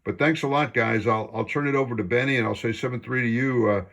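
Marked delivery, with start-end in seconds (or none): none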